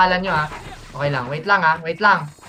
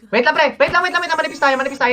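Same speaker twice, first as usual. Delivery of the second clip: high, pressing wait lang repeated; urgent hold call; 7 semitones higher than usual. Real, not acted. Shotcaller telling team to hold, high urgent call. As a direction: stop them mid-push, urgently.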